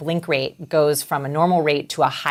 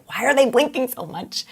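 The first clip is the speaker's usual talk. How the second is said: higher, incredulous quoted question; baffled onlooker's higher voice; puzzled question; 8 semitones higher than usual. Retold or imitated. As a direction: wonder it aloud, pitched up.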